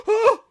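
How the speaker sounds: high, loud gasping "Oh!"; excited gasp. Retold or imitated. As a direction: gasp out a thrilled "Oh!". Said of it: "I wanted to go".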